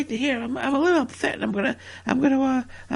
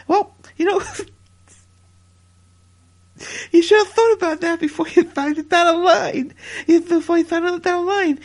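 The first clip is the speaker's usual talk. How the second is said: Nia Jax voice; 4 semitones higher, 4 dB louder than usual. Retold or imitated. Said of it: "Nia Jax is like".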